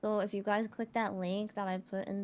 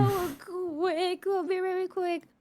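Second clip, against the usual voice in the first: squeaky high-pitched hurry; 11 semitones higher, 3 dB louder, slower than usual. Real, not acted. Rushing off to the bathroom.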